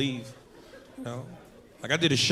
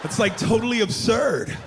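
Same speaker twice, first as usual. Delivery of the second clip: lightly voiced offended bro; 3 semitones higher, slower than usual. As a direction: brush him off like a surfer. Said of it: "He was like".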